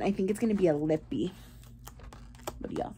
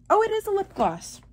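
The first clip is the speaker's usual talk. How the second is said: surprised; 12 semitones higher than usual. Real, not acted. Surprise at gift.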